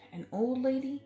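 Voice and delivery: usual speaking voice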